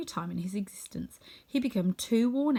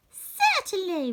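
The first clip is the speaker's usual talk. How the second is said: much higher, slow and louder squeak; a tiny queen's gracious reply; squeaky mouse queen; 12 semitones higher, 6 dB louder, slower than usual. Acted. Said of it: "her squeaky little voice".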